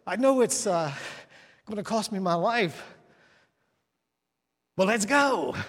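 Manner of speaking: a follower who knows it will cost his life; resigned disciple. Retold or imitated. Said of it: "there's this other one".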